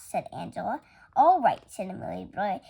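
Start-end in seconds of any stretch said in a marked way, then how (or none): 1.2-1.6 eager agreement. Acted. Amelia agrees to play house.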